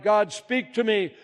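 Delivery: high, loud cry to God. Acted. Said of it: "I cried".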